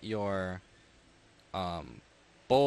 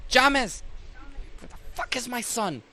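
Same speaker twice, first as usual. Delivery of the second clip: angry mother; 10 semitones higher, 3 dB louder than usual. Acted. Angry halfling mother bursts in.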